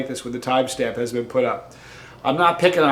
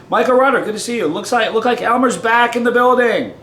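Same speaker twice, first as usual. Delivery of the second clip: high, loud announcing cheer; excited welcome; 9 semitones higher, 6 dB louder than usual. Real, not acted. Host welcoming Michael back to the show, high excited call. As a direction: hail him like a returning star. Calls out greeting to returning viewer.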